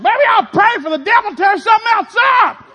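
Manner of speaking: high loud mocking shout; sarcastic yell. Sarcastic yelled retort.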